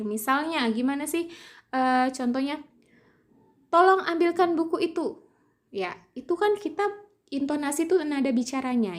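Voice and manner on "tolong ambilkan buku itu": "Tolong ambilkan" pitched high, dropping at "buku itu"; command intonation. Shown as a demonstration. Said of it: "berintonasi tinggi di awal dan berintonasi rendah".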